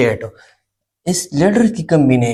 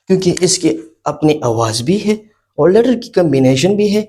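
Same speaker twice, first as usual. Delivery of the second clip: usual speaking voice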